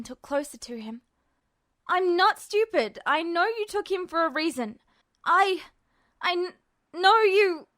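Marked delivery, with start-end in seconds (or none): 1.9-7.7 panicked protest. A narrator's reading. Ed arguing in panic.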